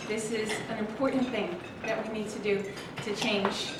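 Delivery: normal talking voice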